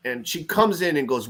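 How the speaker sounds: normal talking voice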